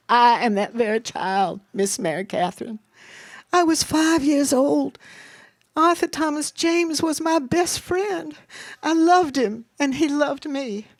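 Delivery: high, grieving, gin-soaked voice; tearful tipsy confession. A narrator's reading. Mama confesses she was that very child, blubbering. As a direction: pour it out, pitch climbing with grief. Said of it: "blubbering with her gin".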